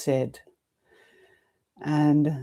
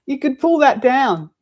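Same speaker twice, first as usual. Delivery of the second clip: higher and louder instruction across the room; teacher tells assistant to pull the blind, called out; calling out; 8 semitones higher, 6 dB louder than usual. Real, not acted. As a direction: direct her from your seat, raised voice.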